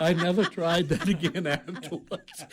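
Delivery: talking through laughter; laughing. Real, not acted. Warden laughing at his failed French. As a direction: laugh as you admit it.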